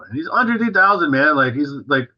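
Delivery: usual speaking voice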